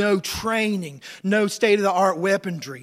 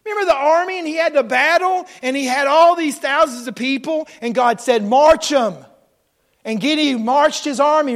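loud, raised-pitch sermon storytelling; full-force preaching; 6 semitones higher, 8 dB louder than usual. Acted. Preacher recalling Gideon's army, full-force preaching. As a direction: preach it out to rouse the room.

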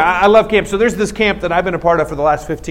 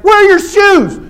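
high, loud imitated yell; scolding sponsor; 14 semitones higher, 5 dB louder than usual. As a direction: yell it like an outraged camp sponsor. Acted angry sponsor scolding kid.

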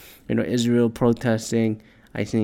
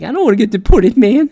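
high, loud panicky whine; mock scared whine; 11 semitones higher, 8 dB louder than usual. Backing down from his own joke, high mock panic. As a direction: chicken out in a squeaky voice. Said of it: "I'd be like".

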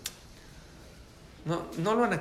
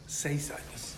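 near-whispered revelation; soft, breath-only whisper for weight; hushed awe; 7 dB quieter, much slower than usual. Real, not acted. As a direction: let it out under the breath. Answering own question, hushed in awe.

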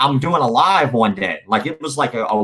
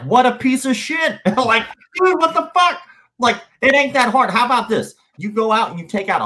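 pitched way up in disbelief, exasperated; outraged rant; 10 semitones higher than usual. Real, not acted. Ranting about a promoter who didn't pay fighters, high exasperated outburst.

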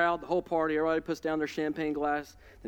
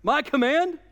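fired-up king; 5 semitones higher, 4 dB louder than usual. Retold or imitated. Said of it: "He's like".

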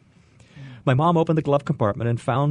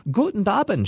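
put-on high, glad German greeting from an old lady; old woman cries out; 8 semitones higher than usual. A narrator's reading. Old German woman greeting her guests, joyful high call. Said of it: "she cried".

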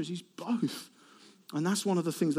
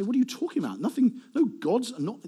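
higher-pitched protesting question; acted incredulous objection; 6 semitones higher than usual. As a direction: object as if it were absurd. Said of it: "pagan religions would say".

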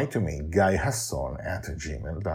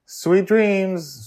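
high, tender grandma; 14 semitones higher, 8 dB louder than usual. Acted. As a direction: wish goodnight like a granny. Susie's voice.

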